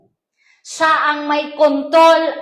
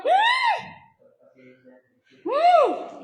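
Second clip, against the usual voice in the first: high strained hum of holding back anger; held-in annoyance. Shown as a demonstration. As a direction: swallow the anger with a tight hum.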